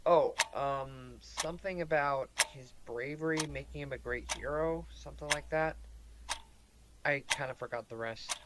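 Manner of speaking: sheepish mumble. Acted. Caught not listening, mumbling.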